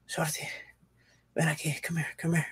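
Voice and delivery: faint, fading beckon; hammed-up feeble plea, barely there; weak dying call. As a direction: call him over like you're at death's door. Exhausted friend calling him over.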